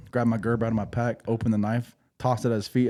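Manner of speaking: normal talking voice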